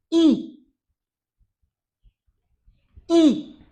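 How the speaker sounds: high, loud, isolated letter sound; loud vowel model. Shown as a demonstration. Teacher models the next vowel, high and loud.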